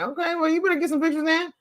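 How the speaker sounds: high, teasing retort voice; mock bossy. Teasing mom about pictures in a high mock voice. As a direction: pitch it high like telling mom off playfully. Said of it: "I'm like".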